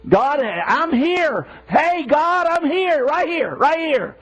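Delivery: high-pitched, insistent plea to be noticed; pleading "over here" call; calling out to God. Acted. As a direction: call upward as if trying to flag God down. Acted plea for God's attention.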